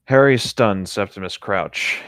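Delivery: ordinary talk, nothing marked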